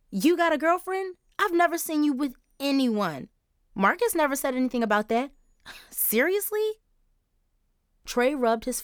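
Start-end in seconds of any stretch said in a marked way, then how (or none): 0.1-6.8 put-on high shocked girl voice. Charisse shocked by Trey's news.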